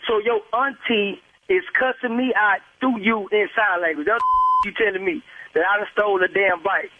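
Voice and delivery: ordinary talk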